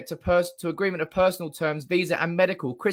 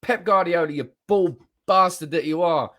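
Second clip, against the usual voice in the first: normal talking voice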